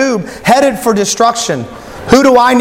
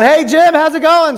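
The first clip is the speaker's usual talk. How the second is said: high-pitched call to someone below; mock yell down; 8 semitones higher than usual. Friend on cliff to Jim on river, mock calling out. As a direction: call out casually, pitched up to carry. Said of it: "You just yell down".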